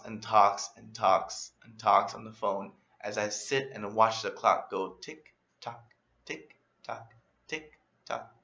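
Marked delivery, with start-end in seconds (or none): 4.9-8.3 much softer, trailing tick-tock; dwindling, softer ticking; fading tick-tock. Retold or imitated. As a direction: let the clock sound fade out as you wait. Bored waiting, clock ticking.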